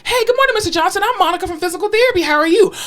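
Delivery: high, bubbly introduction; extra-cheery mock greeting. Therapist trying again with patient, put-on cheer.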